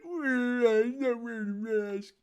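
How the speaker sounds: slow, drawn-out whine. Retold or imitated. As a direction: stretch the quote out mockingly.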